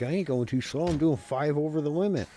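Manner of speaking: loud, high protest of innocence; indignant retort. Retold or imitated. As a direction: shout back, offended. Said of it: "I'm like".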